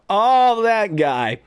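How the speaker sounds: loud, high "oh" falling back down; high, drawn-out realisation; sudden recognition. Pretend. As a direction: act out the moment it dawns on you. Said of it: "and then you'll go".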